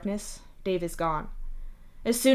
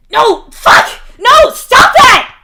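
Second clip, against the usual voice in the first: much higher, loud, slow furious yell; barking shout; 19 dB louder, much slower than usual. Acted. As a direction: bark it at her to stop. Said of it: "Mituna barks at her".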